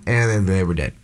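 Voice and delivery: ordinary talk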